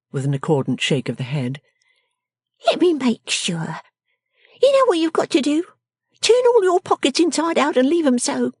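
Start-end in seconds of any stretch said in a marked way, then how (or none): 2.7-8.5 high, commanding little voice; scolding cry. A narrator's reading. Miss Wren ordering his pockets turned out, high and scolding. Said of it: "cried the person of the house".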